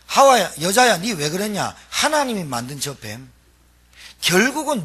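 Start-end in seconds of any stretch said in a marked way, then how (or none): 0.1-1.7 louder call of 하와야 and 여자야; blaming call. Acted. Adam blaming the woman before God, a sharp louder call. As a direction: call her out with blame.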